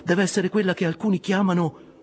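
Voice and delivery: very high character voice, dropping away at the end; harpooner's voice. A narrator's reading. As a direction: voice a harpooner asking his captain, pitched high. Harpooner speaks to captain. (voce acuta di ramponiere)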